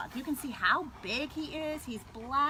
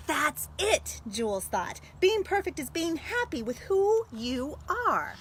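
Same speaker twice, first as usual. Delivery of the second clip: louder, animated read-aloud; story reading; 4 dB louder than usual. Acted. Librarian reading the story's ending to students. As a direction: tell it with full storyteller energy. Reads picture book aloud.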